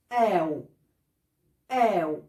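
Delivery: آو said as a gliding ow; ow sound. Shown as a demonstration. Teacher showing students how o plus u sounds. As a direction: glide the vowel into ow. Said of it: "هيبقى شكله زي".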